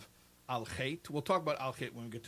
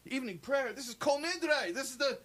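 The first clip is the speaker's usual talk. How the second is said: high, loud indignant shock, slowing on Kol Nidre; outraged visitor; 13 semitones higher, 5 dB louder than usual. Retold or imitated. Offended visitor protesting to the sexton, hammed-up indignation. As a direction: sputter in mock outrage. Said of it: "He's like".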